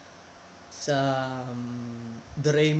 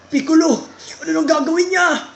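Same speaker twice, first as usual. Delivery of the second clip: excited anime voice; 8 semitones higher, 4 dB louder than usual. Pretend.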